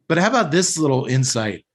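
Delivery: normal talking voice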